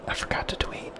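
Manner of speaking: hushed whisper close to the mic. Real, not acted. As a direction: whisper it so no one hears. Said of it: "I had to whisper".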